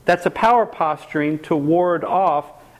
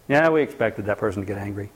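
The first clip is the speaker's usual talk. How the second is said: dismissive; 9 semitones lower, 4 dB quieter, faster than usual. Retold or imitated. Family dismissing the angry person.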